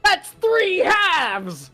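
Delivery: high, slow, drawn-out character voice; excited character line. Retold or imitated. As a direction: stretch it out, way up high. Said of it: "you were very excited for".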